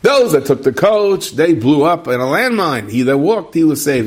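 exaggerated Hollywood-style storytelling; movie-world version. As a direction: ham up a storybook ending. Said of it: "in the movie world".

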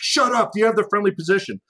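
impatient, rushed radio voice; urgent radio bark. Retold or imitated. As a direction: snap it out like a controller mid-firefight. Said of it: "The guy's like".

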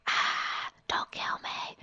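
hushed, voiceless mock plea; play-acted dying gasp and whispered plea; mock horror victim. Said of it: "as the people get killed".

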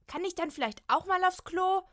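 high, loud, angry snap; hissing snap. Acted. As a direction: snap at the bathroom door. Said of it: "faucht Kim". (wütend und laut fauchen)

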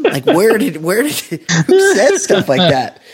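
can't believe it, laughing; laughing disbelief. Real, not acted. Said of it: "laughing disbelief".

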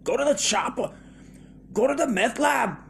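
put-on strained high shout, accent slipping toward Irish; Arnold Schwarzenegger. Action hero sending people to the meth lab, shouted high. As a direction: yell it like a hammed-up action hero. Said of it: "It still sounds Irish".

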